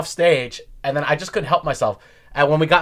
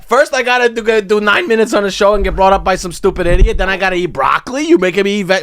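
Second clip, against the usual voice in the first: higher-pitched mock domestic tirade; hammed-up aggrieved rant, piling up complaints; ranting husband; 5 semitones higher, 4 dB louder than usual. Comic home from bad gig complains to wife, mock furious.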